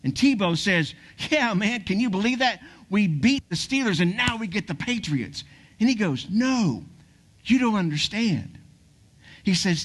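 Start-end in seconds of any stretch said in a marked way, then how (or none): 1.3-5.4 higher, pumped-up victory voice; excited. Acted. Tebow to his publicist, thrilled after the win, higher and excited. 6.3-8.6 urgent publicist. Acted. Publicist insisting to Tebow.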